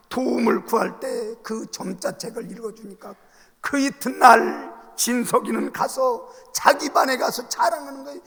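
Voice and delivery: ordinary talk, nothing marked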